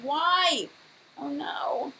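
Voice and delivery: ordinary talk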